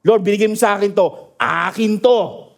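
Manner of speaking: rushed prayer, then a slow drawn-out "Akin to"; slow declaration. Shown as a demonstration. Preacher showing the flock how to claim their home, slow declaration. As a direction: claim "Akin to" slowly, word by word. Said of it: "practical na pwede mong gawin".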